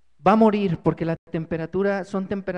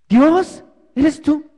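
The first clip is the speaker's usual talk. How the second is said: louder and higher, in disbelief; startled outcry; 6 semitones higher, 8 dB louder, much slower than usual. Acted. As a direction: call out in shock, unsure who answers. Climber startled by the voice. (grito de asombro)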